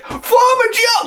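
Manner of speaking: put-on very high, thrilled child shout; kid's delighted shout. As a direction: squeal it like an overjoyed little boy. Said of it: "He's like".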